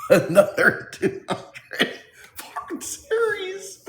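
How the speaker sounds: high-pitched laughing through the words; cracking up mid-sentence, high. Real, not acted. Laughing hard at chat.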